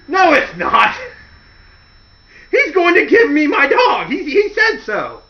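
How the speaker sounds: high, loud naive insisting; mock stubborn, high and loud; stubborn insistence. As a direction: insist loudly like a trusting fool. Voicing stubborn Al.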